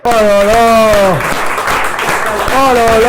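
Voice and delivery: loud, high, drawn-out outcry; loud exclamation. Real, not acted. Host reacts to a contestant's joke, loud exclamation. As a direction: stretch it out in loud dismay.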